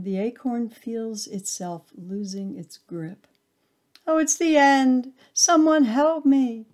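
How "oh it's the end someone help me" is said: high, loud panicked plea; desperate cry for help. A narrator's reading. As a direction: scream for help as the grip goes. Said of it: "its cries go unanswered".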